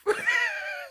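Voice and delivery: sharp high laugh; high burst of laughter. Real, not acted. Telling of life in the South, a high burst of laughter.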